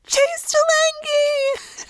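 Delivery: long, high, loud scream of his name; thrilled shriek of a secret crush's name. Retold or imitated. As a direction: belt his name out on the ride. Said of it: "I screamed".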